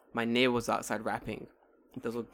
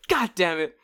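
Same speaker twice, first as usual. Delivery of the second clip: ordinary delivery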